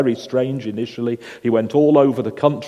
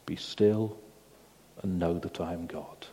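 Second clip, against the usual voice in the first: much softer and lower, near silence; hushed divine answer; 6 semitones lower, 12 dB quieter than usual. A narrator's reading. Preacher quoting God's answer to the grieving mother, hushed. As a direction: hand down the words as a still small voice.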